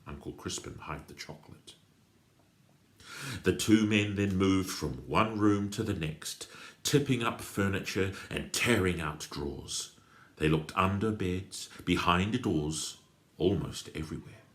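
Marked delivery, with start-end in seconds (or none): none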